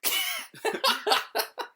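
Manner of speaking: high, loud, ringing laugh; loud exit laugh. Retold or imitated. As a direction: let out a big loud cackle. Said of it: "I was like".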